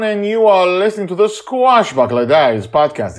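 big, loud, high announcer intro; podcast intro. Acted. Host welcoming listeners back, loud, high announcer voice. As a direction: boom out the show's welcome like a host.

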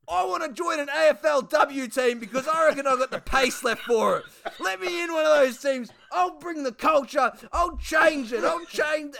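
high, loud mock shout; Duck's voice. Duck begging to join a women's team, high shouting. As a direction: yell it way up like an overexcited player.

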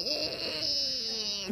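husband pained by the cost. Retold or imitated. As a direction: wince at the ledger. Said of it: "you're going".

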